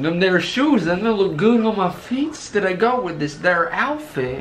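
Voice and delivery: hammed-up southern accent. Retelling her asking about shoes and outfit, southern accent. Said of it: "southern accents".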